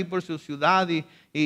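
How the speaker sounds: normal talking voice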